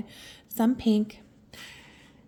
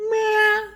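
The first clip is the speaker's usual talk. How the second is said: playful meow; 11 semitones higher, 5 dB louder than usual. Pretend. Playful kiss noise.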